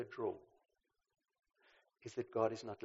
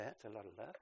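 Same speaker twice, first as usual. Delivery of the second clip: dry irony; 4 semitones lower, 12 dB quieter than usual. Retold or imitated. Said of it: "you'd say".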